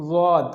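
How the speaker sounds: ضاد with tongue side on upper molars, thick; ضاد demo. Shown as a demonstration. Tajweed teacher demonstrating ضاد to students. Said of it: "تو اس کو پڑھیں گے".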